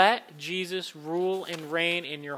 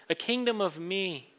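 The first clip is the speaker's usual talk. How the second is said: normal talking voice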